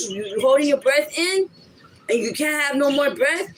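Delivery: usual speaking voice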